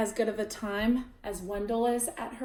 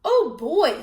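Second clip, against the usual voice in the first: loud, high eager cheer; loud excited kid; thrilled; 5 semitones higher, 9 dB louder than usual. A narrator's reading. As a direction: shout it with glee. Wendell thrilled.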